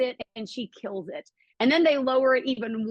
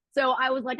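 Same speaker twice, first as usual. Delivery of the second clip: normal talking voice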